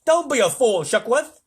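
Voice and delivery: loud, high, irritated snap; testy controller's rebuke; snapped. A narrator's reading. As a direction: snap at a foolish subordinate. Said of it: "snapped ground control".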